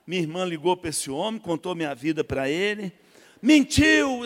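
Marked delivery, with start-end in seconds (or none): 3.4-4.2 preacher's call. Retold or imitated. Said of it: "a falação dele".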